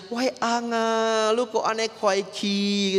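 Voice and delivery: high, slow mock lament over the husband; mock wailing. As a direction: wail a fake widow's cry, drawn out. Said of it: "Nanti dia nangis". (tangisan pura-pura yang tinggi dan lambat)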